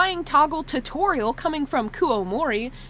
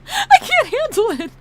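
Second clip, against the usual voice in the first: very high, loud tearful squeal; crying laughing; 10 semitones higher, 6 dB louder than usual. Real, not acted. Streamer who can't handle crying toggles, crying laughing squeal. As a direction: squeal it helplessly through laughter. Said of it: "I'm crying laughing right now".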